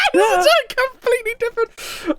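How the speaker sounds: squeaky laugh through the words; cracking up mid-sentence. Real, not acted. GM laughing at player's choice.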